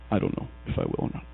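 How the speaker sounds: low, breathy throwaway aside; whispered aside. Real, not acted. Preacher hedging to himself, under his breath. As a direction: mutter it to yourself, barely voiced. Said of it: "aside to himself".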